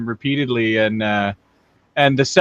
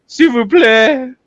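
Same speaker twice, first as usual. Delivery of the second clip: mock French plea; 7 semitones higher, 4 dB louder than usual. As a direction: beg in a hammy French accent. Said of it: "They'll be like".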